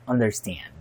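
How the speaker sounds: "Understand" with American 'a' as in cat in "stand". Shown as a demonstration. Reviewer demonstrating "Understand" with American 'a' in the last syllable. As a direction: say "un-der-STAND" with an American vowel. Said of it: "Stand with American A".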